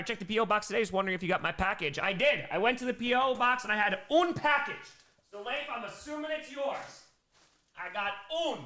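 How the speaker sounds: ordinary talk, nothing marked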